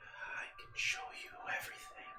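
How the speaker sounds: eerie unvoiced whisper, right in the ear. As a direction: tempt them in a breathless whisper. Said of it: "you almost just hear this whisper into your ear".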